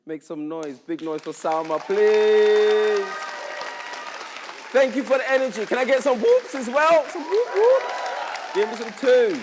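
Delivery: ordinary talk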